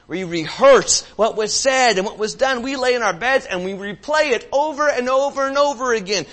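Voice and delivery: loud, high driving sermon; preaching at full force. Acted. Pastor urging the congregation who can't move on, loud preaching. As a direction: press it home, repeating and building.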